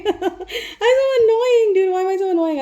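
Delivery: high, loud giggly self-mockery; laughing at herself. Real, not acted. Cracking up at her own goofing during the reading, laughing. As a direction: laugh through the words at how silly you are.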